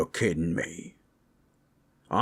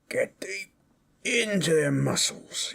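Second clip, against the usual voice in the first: higher-pitched strain of kneading hard; effortful pressing; 9 semitones higher than usual. Pretend. Orc digging into a comrade's tight muscles, effortful pressing. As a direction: push the words out while working a muscle.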